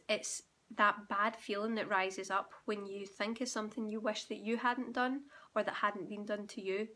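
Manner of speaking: ordinary talk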